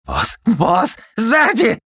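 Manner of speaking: rising, very high panicked yell; frantic scream. A narrator's reading. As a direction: scream a warning in terror. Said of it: "Истошно завопил Дуримар".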